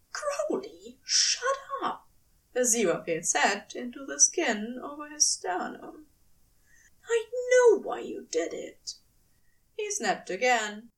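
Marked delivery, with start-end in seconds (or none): 0.1-2.1 much higher, curt angel voice; impatient angel. A narrator's reading. Angel silencing his rambling lover, higher and curt. 7.1-9.0 higher, firm angel voice; knowing angel. A narrator's reading. Angel telling his lover he understands, higher voice.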